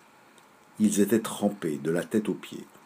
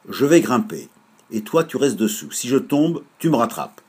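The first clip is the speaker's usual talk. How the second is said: eager; 4 semitones higher, 7 dB louder than usual. Acted. Félix proposes climbing plan.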